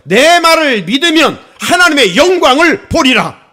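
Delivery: loud, slow and solemn; solemn proclamation. Retold or imitated. Preacher voicing Jesus to Martha, loud solemn proclamation. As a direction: proclaim it over the congregation, slowly. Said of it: "이렇게 말씀하셨어요".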